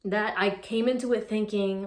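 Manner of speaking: ordinary delivery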